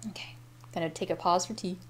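quick whispered aside. Real, not acted. Reader whispering a break for tea aside. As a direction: whisper it off to the side.

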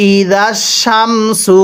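ই জাস Shamsu drawn out slowly, each syllable held; slow teaching demo of ই জাস Shamsu. Shown as a demonstration. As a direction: spell it out slowly for students. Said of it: "কিভাবে পড়তে হবে?".

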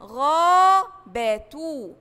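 غابة with the long aa of غا stretched slowly; drawn-out long vowel. Shown as a demonstration. Teacher stretching the vowel for first graders, slow and drawn out. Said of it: "كلمة".

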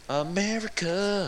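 high, slow, drawn-out cry of America; patriotic cheer. Pretend. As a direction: belt it like a proud mock anthem.

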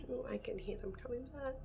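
quick hushed whisper; whispering while hiding. Real, not acted. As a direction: whisper fast so they don't hear. Others returning, hiding from them.

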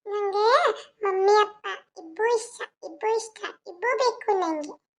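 put-on high, soft, slow baby talk; little girl answering. Child telling the maid she loves both parents, high baby voice. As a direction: put on a small, halting toddler voice.

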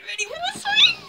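high strained squeal; eating sour candy. Real, not acted. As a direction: squeak it out through the sourness. Cries out at sour taste.

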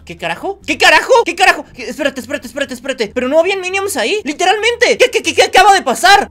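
high, loud startled disbelief; rising panic at the vanished friends; shocked outburst. Real, not acted. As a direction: blurt it out, caught completely off guard. Minions suddenly vanish in game.

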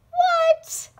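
shrieking high and loud; startled squeal of disbelief; shocked. Acted. Reader startled by the text.

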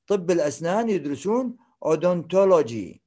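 ordinary talk, nothing marked

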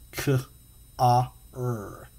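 "K, ah, er" said as separate sounds; teacher sounding out the c sound. Shown as a demonstration. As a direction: segment each sound slowly. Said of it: "it makes the K sound".

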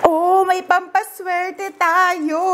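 delighted laughing. Real, not acted. Vlogger finds lucky money in the bag, delighted. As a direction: stretch it out, then burst out laughing.

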